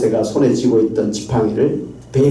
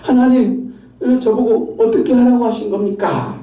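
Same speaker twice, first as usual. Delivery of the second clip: high-pitched, argumentative complaint to God; resentful protest; 9 semitones higher than usual. Retold or imitated. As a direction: act out Moses arguing back, voice pushed up. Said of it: "하나님에게 따지고 원망하면서".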